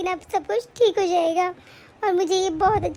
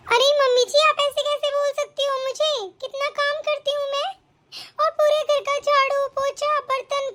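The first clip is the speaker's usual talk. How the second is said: higher-pitched, wounded self-defence; aggrieved, insistent complaint on a raised pitch; hurt protest; 4 semitones higher than usual. Acted. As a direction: plead your case against unfair blame. Wronged daughter-in-law protests to mother-in-law.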